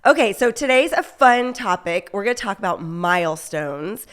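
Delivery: upbeat show intro over music; podcast intro read. Acted.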